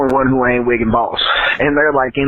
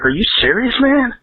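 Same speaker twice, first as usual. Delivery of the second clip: incredulous; 11 semitones higher than usual. Retold or imitated. Dealer questioning repeat acid request.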